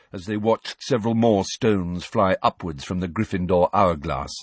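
usual speaking voice

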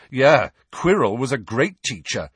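loud, provocative remark. A narrator's reading. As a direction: cut in loudly so all can hear. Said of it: "said Harry loudly".

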